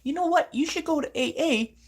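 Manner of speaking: high, loud imitation of parents; parents' voice. Speaker imitating parents telling their child off, high and loud. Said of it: "their parents should be like".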